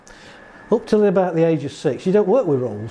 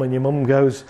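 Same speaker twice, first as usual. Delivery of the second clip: ordinary delivery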